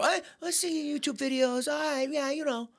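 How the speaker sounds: high, loud sneering hometown voice; mocking Boston local. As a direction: needle the comic who got famous. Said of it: "You know, stuff like that".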